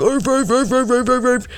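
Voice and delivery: high yappy dog barks; mock barking. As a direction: yap like a little dog. Mimicking barking at party.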